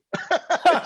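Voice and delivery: loud high laugh; real laugh; high burst of laughter. Real, not acted. Laugh after she almost killed me.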